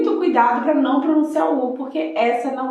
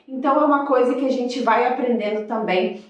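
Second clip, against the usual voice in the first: ordinary talk, nothing marked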